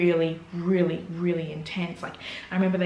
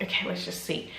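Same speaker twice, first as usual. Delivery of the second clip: resigned whisper; 8 dB quieter than usual. Retold or imitated. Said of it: "I was like".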